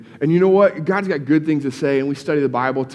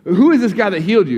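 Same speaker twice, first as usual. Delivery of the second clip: louder, agitated questioning; upset demand; 6 semitones higher, 6 dB louder, faster than usual. Retold or imitated. Pharisees confronting the healed man, upset and loud. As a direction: demand it, indignant and pressing. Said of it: "Pharisees get all upset".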